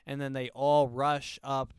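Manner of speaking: ordinary delivery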